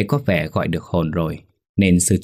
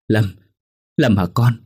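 stammering; 2 semitones higher than usual. Acted. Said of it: "giọng ấp úng".

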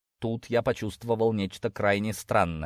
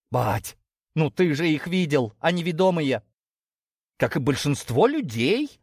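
protesting daughter; 6 semitones higher, 3 dB louder than usual. Acted. Daughter arguing with her father, protesting. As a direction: appeal to him in a raised pitch.

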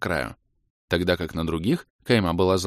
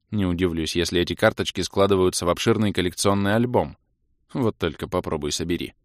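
usual speaking voice

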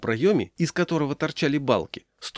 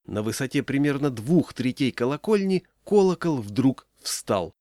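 usual speaking voice

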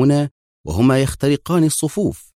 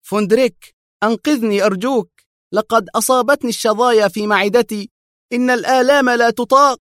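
high, desperate begging; 12 semitones higher, 4 dB louder than usual. A narrator's reading. Henrik begging Vondrik to save him, begging. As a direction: plead for rescue in pain. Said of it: "هتف هنريك في توسل".

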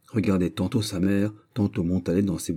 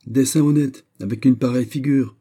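higher, louder mocking exclamation; scornful outcry; 6 semitones higher, 6 dB louder than usual. Old lady cries out at Malicorne, loud and scornful. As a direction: cry out in contempt at his face. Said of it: "s'écria madame de Saint-Rémy".

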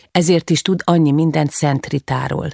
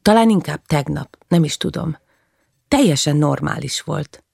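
ordinary delivery, nothing marked

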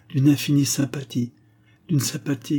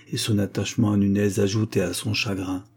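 plain reading voice

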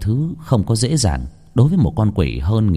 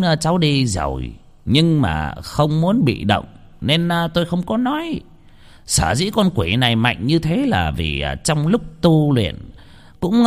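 a sage revealing grim secrets; old master explains; 7 semitones higher than usual. Acted. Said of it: "Ông Phong nói".

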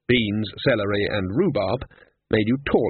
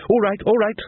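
higher-pitched, hasty concession; wary giving up; 11 semitones higher, 4 dB louder than usual. A narrator's reading. Ridcully stops pulling at their story, wary concession. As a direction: cut off the excuses before they unravel. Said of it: "voice of a man who knows".

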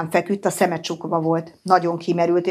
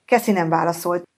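ordinary delivery, nothing marked

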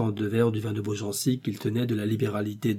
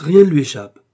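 loud, higher burst of admiration; emphatic exclamation, punched louder; exclaiming; 5 semitones higher, 13 dB louder than usual. A narrator's reading. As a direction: cry it out with conviction. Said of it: "s'écria Athos".

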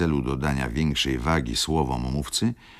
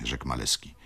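ordinary delivery, nothing marked

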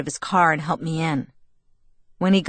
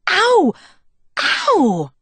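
high, loud yelp of pain; screaming in pain; 13 semitones higher, 5 dB louder than usual. A narrator's reading. Bella hurting her hand on Jacob's face, screaming. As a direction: cry out sharply as pain hits. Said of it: "I screamed".

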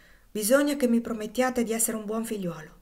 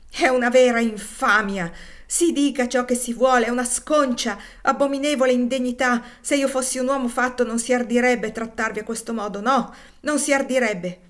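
loud, higher furious outburst, fighting back tears; a boy roaring with rage he can hardly hold in; barely held roar; 5 semitones higher, 8 dB louder than usual. A narrator's reading. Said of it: "esclama con una specie di ruggito che a stento trattiene". (ruggito furioso e trattenuto, più forte e più acuto)

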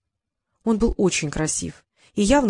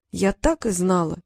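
ordinary delivery, nothing marked

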